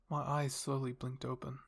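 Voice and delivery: ordinary delivery, nothing marked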